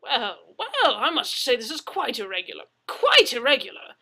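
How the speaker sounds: loud, high blustering Englishman. Acted. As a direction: bluster in outrage.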